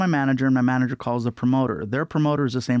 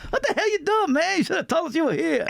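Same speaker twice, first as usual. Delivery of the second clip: imitating Chris Rock. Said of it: "He's like".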